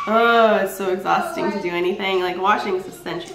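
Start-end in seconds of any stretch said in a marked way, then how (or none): none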